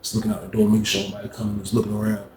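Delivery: usual speaking voice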